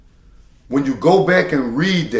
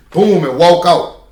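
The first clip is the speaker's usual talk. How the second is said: ordinary talk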